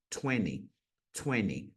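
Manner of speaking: TWENTY as "twenny"; American TWENTY without its middle T; twenty, T dropped. Shown as a demonstration. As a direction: let the second T vanish in TWENTY. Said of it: "sumindo com esse T".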